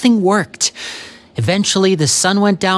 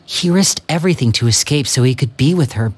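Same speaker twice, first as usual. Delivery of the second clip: ordinary talk, nothing marked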